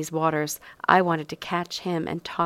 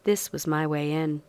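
usual speaking voice

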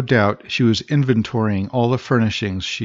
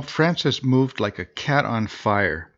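usual speaking voice

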